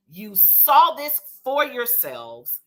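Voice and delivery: ordinary talk, nothing marked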